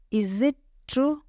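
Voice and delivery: "Is it ... true", drawn out with a gap before true; slow teaching repeat. Shown as a demonstration. Teacher repeating a question for learners, slowly and split up. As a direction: stretch "Is it" and set "true" apart.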